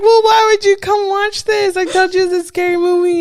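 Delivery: high, loud mock exasperation; mother retorting to scared son, high and loud; high exasperated. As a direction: squeak it out in disbelief. Exasperated retold reply.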